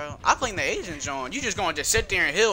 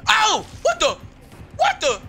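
raging gamer; 3 dB louder than usual. Real, not acted. Gamer getting hit in match.

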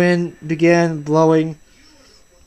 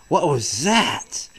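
plain reading voice

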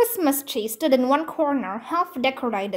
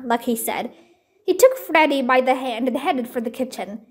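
plain reading voice

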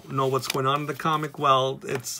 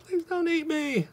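high squeaky plea of a tiny cartoon victim; hammed-up pleading in a very high little voice; mock cry of anguish. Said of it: "crying out in anguish".

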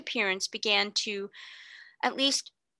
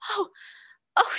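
breathless fright; 11 semitones higher than usual. A narrator's reading. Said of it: "she cried under her breath".

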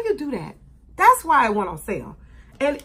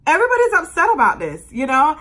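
upset outburst; 5 semitones higher, 7 dB louder, faster than usual. Real, not acted. Vlogger upset about shirt.

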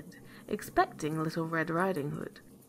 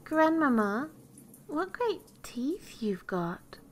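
high, slow wonder; amazed little girl; 8 semitones higher, slower than usual. A narrator's reading. Girl marvels at granny in bed, high and amazed. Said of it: "being greatly amazed to see".

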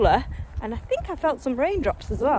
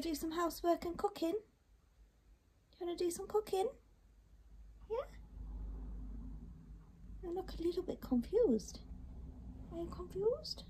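hushed, higher voice for the dog; soft pet talk; 8 semitones higher, 11 dB quieter than usual. Real, not acted. Owner softly asks her dog if it's confused, hushed pet talk. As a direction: murmur gently to a puzzled pet.

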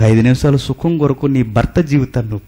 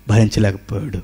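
ordinary delivery

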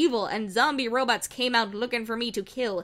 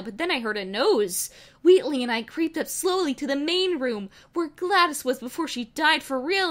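ordinary delivery

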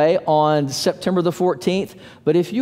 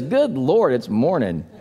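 groggy groan; 6 semitones lower than usual. Retold or imitated. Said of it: "another guy gets up and he says".

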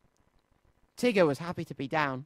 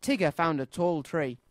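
ordinary delivery

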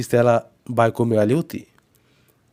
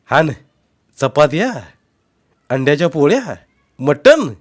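delighted, growing louder through the list of food; joyful; 4 semitones higher, 6 dB louder than usual. A narrator's reading. Husband unpacking the food bundle, delighted and louder. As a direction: savour each dish with glee. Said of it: "तो आनंदानं म्हणाला".

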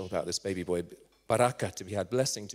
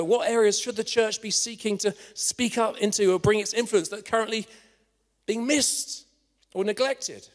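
raised, emphatic pulpit voice; rousing sermon appeal; impassioned preaching; 9 semitones higher, 5 dB louder than usual. Real, not acted. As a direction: preach it higher, urging the congregation to act.

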